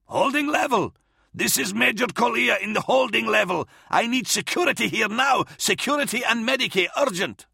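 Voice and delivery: high, urgent officer shouting for help; urgent vox call. Acted. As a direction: call for security over the comm.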